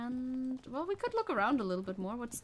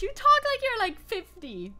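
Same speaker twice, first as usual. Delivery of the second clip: surprised; 13 semitones higher, 7 dB louder than usual. Real, not acted. Sudden mock outrage at line.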